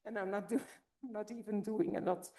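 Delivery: ordinary delivery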